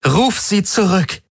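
very high, slow desperate order; trembling desperation. A narrator's reading. Ylana cornered, last order to Galen, trembling desperation. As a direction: let distress show through the command. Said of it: "Jetzt hörte er das Zittern in ihrer Stimme".

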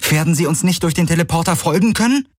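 fast, high-pitched urgent question; calling out. A narrator's reading. Rider asks about pursuers following through the teleporter, calling out. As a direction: call it out quickly, voice pitched well up.

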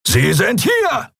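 high, drawn-out roar. A narrator's reading. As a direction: bellow it to alert the others. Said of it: "brüllte einer von ihnen".

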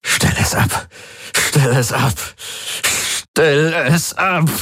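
from voiceless to a high, drawn-out cry; tormented plea rising to a slow high wail; desperate building plea. Acted. Tormented man begs the Helix.

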